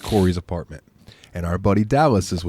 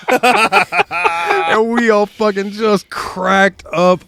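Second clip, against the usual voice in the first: laughing; 11 semitones higher, much slower than usual. Real, not acted. Laughing while telling.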